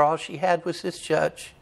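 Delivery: ordinary talk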